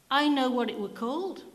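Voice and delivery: put-on fast, loud, high eager boy; a small boy eager to tell the vicar. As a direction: rush it out like a keen child. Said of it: "said the small, wiry boy".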